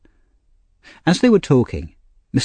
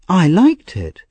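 Oliver; 7 semitones higher, slower than usual. A narrator's reading.

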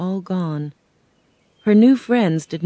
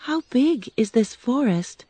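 put-on higher, girlish Dorothy voice; a worried little girl asking, pitched up; young girl Dorothy; 6 semitones higher than usual. Dorothy asking the Tin Man, girlish and higher.

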